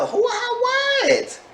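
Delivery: very high-pitched squeal of disbelief; shocked disbelief. Retold or imitated. Telling viewers her reaction to his lateness, shocked. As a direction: shriek it high, as if astonished.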